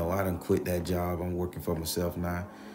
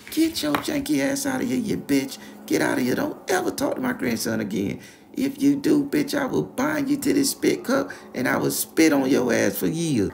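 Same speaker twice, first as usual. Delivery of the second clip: very high, loud scolding grandma; furious grandma; 6 dB louder than usual. Retold or imitated. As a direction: curse him out of the house. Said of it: "going off like that".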